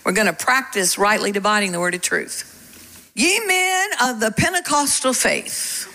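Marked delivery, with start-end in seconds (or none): 3.1-5.9 higher, slow declamation; mock proclamation. Preacher testing congregation with a false verse, mock proclaiming. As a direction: proclaim a mock scripture line, drawn out.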